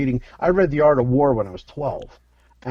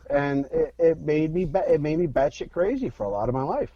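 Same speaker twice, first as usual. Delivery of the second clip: ordinary delivery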